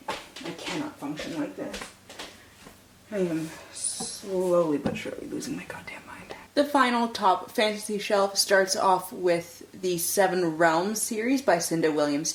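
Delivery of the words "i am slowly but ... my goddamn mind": soft unvoiced whisper; hushed muttered asides. Real, not acted. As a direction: whisper as if not to wake anyone. Whispering to camera.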